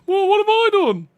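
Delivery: very high, loud mock wail; hammed-up high protest from a picked-on victim; put-upon Rock's voice. Rock just sitting there, answering the screaming tank, mock-wounded wail. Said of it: "it's just sitting there going".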